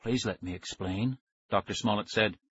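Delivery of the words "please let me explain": soft-spoken scientist. A narrator's reading.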